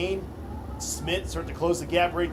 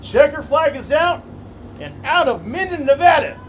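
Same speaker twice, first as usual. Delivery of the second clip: excited race call; 8 semitones higher, 8 dB louder than usual. Real, not acted. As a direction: call the finish with full hype. Final lap race call.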